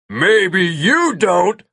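slow, high, drawn-out roar; bellow. A narrator's reading. Nilo bellows back mid-chase as he swings, drawn-out roar. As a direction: bellow it, stretching every word. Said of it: "Nilo bellowed".

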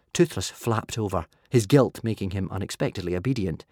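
ordinary delivery, nothing marked

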